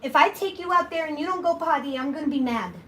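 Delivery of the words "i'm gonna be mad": usual speaking voice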